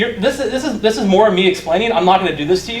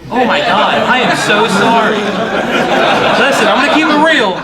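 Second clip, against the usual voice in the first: loud, raised, real flustered outburst; flustered apology; 3 semitones higher, 4 dB louder than usual. Real, not acted. Presenter to laughing audience about unreadable slides, flustered.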